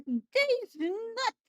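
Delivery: high silly punchline voice; joke voice. Pretend. Repeats the joke.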